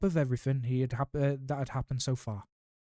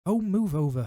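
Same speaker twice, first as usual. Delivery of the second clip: higher, louder impatient snarl; 6 semitones higher, 5 dB louder than usual. A narrator's reading. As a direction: shove them aside with a snarl. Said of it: "Hermione snarled".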